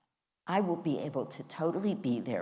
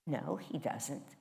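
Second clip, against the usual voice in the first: ordinary talk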